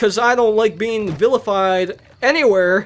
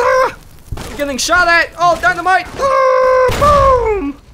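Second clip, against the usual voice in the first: panicked yelling; 12 semitones higher, 6 dB louder than usual. Real, not acted. Under fire in game.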